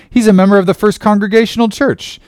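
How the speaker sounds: high, loud defensive claim; insistent protest. A narrator's reading. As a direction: blurt it out in a high voice. Ishmael protests to Bildad.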